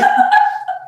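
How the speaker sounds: shrieking laugh; high shriek laugh. Real, not acted. Burst of laughter.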